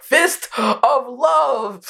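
very slow, high, drawn-out cry through laughter; laughing mock attack call. Quoting Garp's move to friends, laughing mock cry. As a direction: stretch each word out high while laughing.

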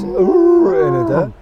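high pitched donkey ooh sound. Shown as a demonstration. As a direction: hit a squealing high ooh. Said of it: "you can't get this".

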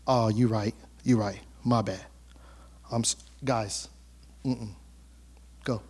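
a hint of a cowed man folding; mock humble retreat, low and quiet; sheepish backing down. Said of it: "You'd be like".

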